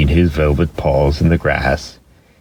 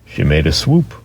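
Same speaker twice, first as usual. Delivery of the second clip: ordinary delivery, nothing marked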